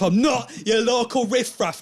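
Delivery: high, loud outraged repeat; escalated indignation. Acted. Drunk man repeating himself to the comic, outraged. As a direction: push NOT up and out, outraged. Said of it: "you repeat things".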